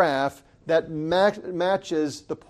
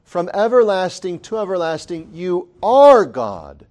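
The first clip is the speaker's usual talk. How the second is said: proclaiming; 7 dB louder than usual. Acted. Preacher proclaiming the psalm.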